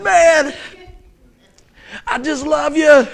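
high, loud, hammed-up tearful outburst; mock crying. Dad breaking down over his son, mock weeping. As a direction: act out blubbering affection. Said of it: "I'm crying".

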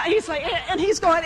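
ordinary talk, nothing marked

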